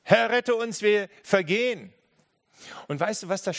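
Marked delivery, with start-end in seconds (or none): 0.0-1.9 loud, high desperate plea; panicked cry. Acted. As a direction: cry out for rescue in the storm. Disciples crying out in storm.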